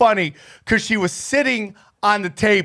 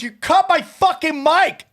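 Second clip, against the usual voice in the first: retold angry yell; 8 semitones higher than usual. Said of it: "She goes".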